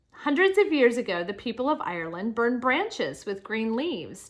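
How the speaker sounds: projected, lilting read-aloud with punched openings; reading to children. Acted. Teacher reads Saint Patrick's life story to children, animated read-aloud. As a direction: read clearly to the class, lifting key words.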